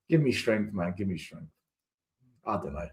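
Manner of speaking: low, soft, deflated sigh; weary resignation. Real, not acted. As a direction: let the air out, defeated. Weary sigh after rant.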